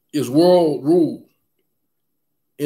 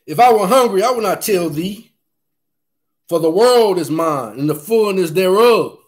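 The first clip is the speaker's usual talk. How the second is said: higher, louder psalm proclamation; preaching at full force; 6 semitones higher, 3 dB louder than usual. Acted. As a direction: proclaim it like God speaking.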